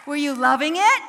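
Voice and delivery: very loud, rousing question; hype shout. Real, not acted. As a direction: pump the audience up. Hyping audience.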